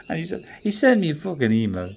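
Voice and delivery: ordinary delivery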